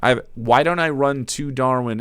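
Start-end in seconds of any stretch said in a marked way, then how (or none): none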